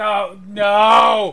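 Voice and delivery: a loud high shout of panic; panicked shout. Acted. Seller screams as the cat is freed, panicked. Cage opens, dealer panics.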